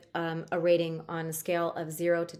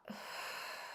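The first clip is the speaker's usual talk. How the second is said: breathy sigh out; 16 dB quieter than usual. Shown as a demonstration. Breathing exercise, breathing out.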